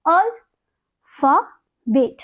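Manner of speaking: अल्फाबेट drawn out slowly in three parts; syllable split. Shown as a demonstration. Teacher showing the three syllables of अल्फाबेट. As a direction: sound out अल्फाबेट slowly, al-pha-bet. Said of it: "तीन syllable है".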